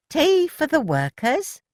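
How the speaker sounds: slow, high cheerful offer; beaming. A narrator's reading. Elsie bringing tea to the workers, slow and beaming. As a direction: beam as you offer tea. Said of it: "beamed Elsie".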